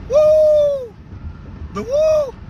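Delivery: high, loud excited whoop. Real, not acted. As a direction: let out a hyped whoop of joy. Hyped up mid motivational speech.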